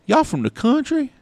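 put-on high-pitched kid's question; twelve-year-old kid. Kid asking a couple if they're country folk, high mimicked voice. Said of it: "about twelve years old".

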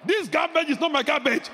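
loud, high shouted rebuke of the bags; commanding shout. Pretend. Preacher shouting at the garbage left by his door. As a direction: bark it out like a command. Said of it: "go outside and say".

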